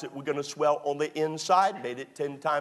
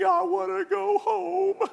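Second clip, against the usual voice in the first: high, slow, tearful whimper of a wife; wife crying; slower than usual. Retold or imitated. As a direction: whine it like a weeping bride. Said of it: "and she's crying, and she's weeping".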